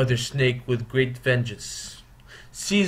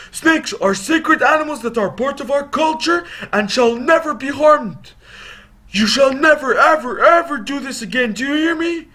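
much higher, loud stern father voice; scolding father; 11 semitones higher, 5 dB louder than usual. Acted. Father catching his sons tormenting snakes, loud stern scolding. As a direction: scold the boys hard. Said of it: "and he says".

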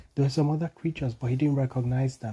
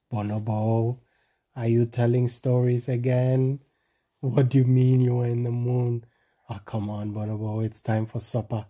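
lower, teasing grandma voice; doubting grandma; 4 semitones lower than usual. A narrator's reading. Grandma to Bonobo about his stories, lower and teasing. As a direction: scold him gently, lower. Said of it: "Grandma said".

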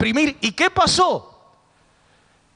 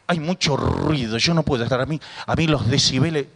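much lower voice of a complaining churchgoer; feligrés quejoso imitado; 8 semitones lower than usual. Retold or imitated. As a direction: imitate a complaint to the pastor, pitched low. Acting a complaining church member. (feligrés que se queja, en voz grave)

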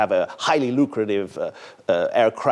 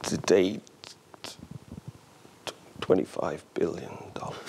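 barely voiced, ashamed whisper; whispered confession; 3 semitones lower, 8 dB quieter than usual. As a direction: breathe out the figure like a guilty secret. Sheepish admission of the loss.

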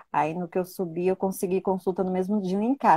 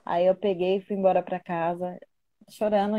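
normal talking voice

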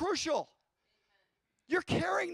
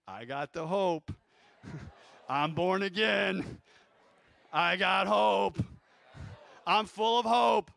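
joyful declaration; 5 semitones lower, 5 dB louder, slower than usual. Acted. Preacher acting out believer's joy.

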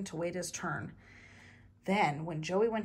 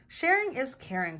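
mildly grumbled, higher pitch; acted sulky recital; grumbling; 6 semitones higher, 4 dB louder than usual. A narrator's reading. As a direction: grumble it like a lectured child. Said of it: "Clark grumbled".

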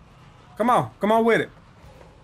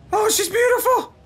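excited shout; 11 semitones higher than usual. Real, not acted. Sees something that excites him.

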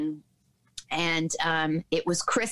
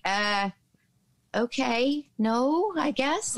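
put-on high, hesitant little-kid voice; imitating her young self, high and tentative; puzzled four-year-old; 8 semitones higher, much slower than usual. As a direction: answer unsurely, pitched well up like a small child. Acting out herself aged four.

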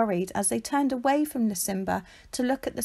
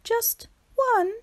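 much higher, wondering; high startled tortoise; small surprised question; 13 semitones higher than usual. A narrator's reading. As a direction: peep out unsure. Shelley surprised.

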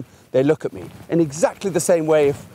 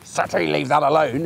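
negative telling-off. Shown as a demonstration. Trainer showing the wrong way, a mild stern telling-off. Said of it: "It's not".

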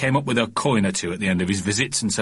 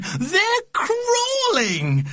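excited cry; much slower than usual. A narrator's reading. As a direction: squeal over the babies. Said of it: "she cried".

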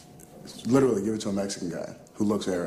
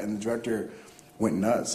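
ordinary delivery

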